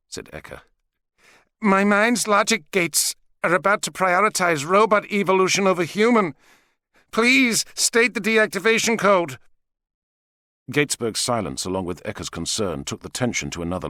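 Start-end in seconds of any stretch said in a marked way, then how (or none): 1.6-9.4 measured, higher robot voice. Acted. Robot Eka warns.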